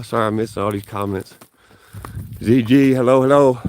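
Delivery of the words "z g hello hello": bright, high, loud hellos; cheerful greeting. Real, not acted. Streamer greeting a viewer in chat, high loud excited hellos. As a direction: greet the viewer with a big lift.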